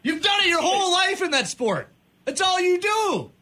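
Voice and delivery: a fed-up host ranting about a soccer player; angry rant. Real, not acted. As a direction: vent at the player who can't do the one thing.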